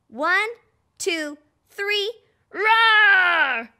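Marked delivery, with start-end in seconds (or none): 2.5-3.7 loud, full-throated mock roar; monster roar. Shown as a demonstration. Host leading kids in a roar, loud monster roar. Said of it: "you need to roar with me".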